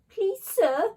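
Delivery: high, loud wail of a bully; a put-on whining plea; wailing whiny bully. A narrator's reading. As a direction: whine it up to the teacher like a tattletale. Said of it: "wailed Brendan the bully".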